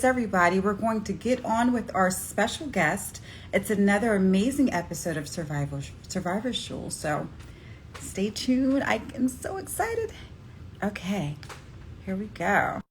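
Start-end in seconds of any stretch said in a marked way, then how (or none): none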